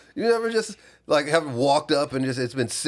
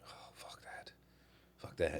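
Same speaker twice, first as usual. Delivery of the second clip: very soft, near-whispered dismissal; low, barely audible grumble; muttered aside; 11 semitones lower, 18 dB quieter than usual. Real, not acted. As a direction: mutter it under your breath. Muttered aside under co-host's rant.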